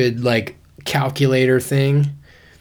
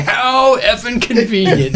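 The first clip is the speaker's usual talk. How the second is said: high, slow, laughing mock scoff; retold sarcasm; 10 semitones higher, 4 dB louder, slower than usual. Imitating the friend who doubted the story, mock sarcasm.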